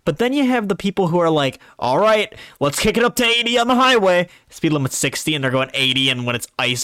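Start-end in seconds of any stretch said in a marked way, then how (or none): 1.8-4.3 reckless driver. Retold or imitated. Imitating reckless highway driver.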